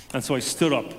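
ordinary talk

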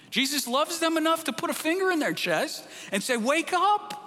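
urgent rising preacher's call; building to a cry. Acted. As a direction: build the line, then jab Wake up like a finger to the chest.

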